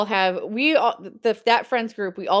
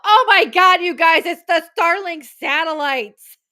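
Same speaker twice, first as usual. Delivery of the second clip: loud, higher, slowing on the last words; excited yell; 9 semitones higher, 7 dB louder, slower than usual. Retold or imitated. Mom shouting to the families on the porch, a loud excited yell. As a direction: shout the discovery to everyone on the porch. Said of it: "you hear me yell".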